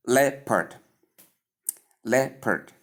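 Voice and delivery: "Leopard" as LEP-erd, two separated syllables, falling; model pronunciation of leopard. Shown as a demonstration. Teacher leading learners in practice, "Leopard" carefully as LEP-erd. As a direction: model "Leopard" with short e and schwa. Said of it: "Say it again".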